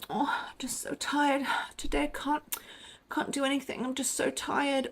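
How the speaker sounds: high, soft whispered whimper; manipulative tired whine. Retold or imitated. Mocking how women guilt men, in a high whimpering whisper. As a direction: breathe it out like a pitiful, exhausted martyr. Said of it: "a female manipulation".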